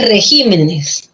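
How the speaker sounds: REGÍMENES slowly, weight on GÍ; stress on GÍ. Shown as a demonstration. Teacher repeats the plural for students, stress on GÍ. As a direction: draw out the GÍ in REGÍMENES. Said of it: "la tilde en I".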